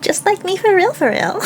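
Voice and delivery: cute anime streamer. Real, not acted. Gleeful self-recognition.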